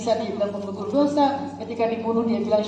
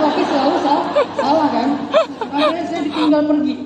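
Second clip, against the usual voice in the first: louder, laughing through it; 5 semitones higher, 8 dB louder than usual. Real, not acted. Husband laughing as he tells how his wife left him. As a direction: laugh while telling it. Laughing retelling wife's anger.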